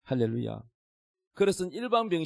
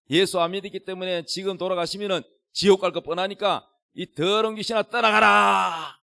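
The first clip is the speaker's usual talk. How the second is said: shouted prayer; 4 semitones higher, 6 dB louder than usual. Retold or imitated. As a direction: re-enact casting out a demon at full voice. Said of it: "고함을 지르면서".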